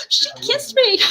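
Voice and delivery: high, loud, breathless delight after a gasp; giddy king. Retold or imitated. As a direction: squeal it like a smitten old king.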